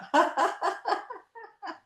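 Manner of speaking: long high staccato giggle; high exercise laugh; laughter. Shown as a demonstration. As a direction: laugh on purpose until it fades. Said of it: "with a sense of fun and laughter".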